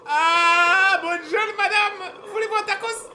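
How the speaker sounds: slow, high, mock-polite voice; silly high voice. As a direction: greet it in a prissy high voice. Jokingly greets the monster.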